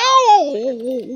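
high startled cry. Real, not acted. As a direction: gasp out a shocked oh. Startled gasp.

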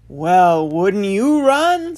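booming, drawn-out lake rising high; loud, slow challenge from a lake; talking lake. Acted. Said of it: "said the lake he'd been in".

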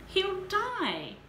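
high, loud frantic plea; desperate cry. A narrator's reading. Mother pleading for her injured son, high frantic cry. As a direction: cry it out in fear.

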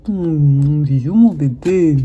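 tender. Real, not acted. Tender reply to the pet.

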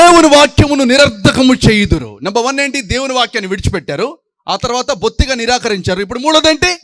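full-force pulpit delivery; preaching at full force. Acted. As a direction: hammer the verse home to the church. Preacher drives home verse loudly.

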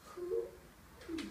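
ordinary delivery, nothing marked

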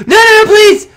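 high, loud burst of alarm; real alarm. Real, not acted. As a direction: yelp as if about to fall. Nearly falls in water.

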